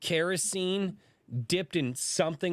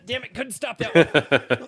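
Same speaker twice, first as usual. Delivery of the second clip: loud, higher, through laughter; laughing; 7 semitones higher, 9 dB louder than usual. Real, not acted. Host who failed to hold back a cough, laughing. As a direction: blurt it out slowly while laughing.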